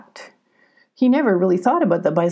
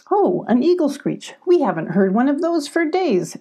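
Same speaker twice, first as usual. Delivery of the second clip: higher, lightly excited storybook voice; pleased excitement; 7 semitones higher than usual. A narrator's reading. Abby hears an eagle screech after days, pleasantly excited. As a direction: voice a character's pleasant surprise.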